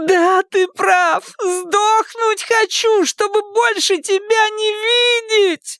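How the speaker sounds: high, drawn-out hysterical wail. A narrator's reading. Daughter screams back at her father, wailing. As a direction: cry it out in tearful fury. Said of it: "вопила Дакота".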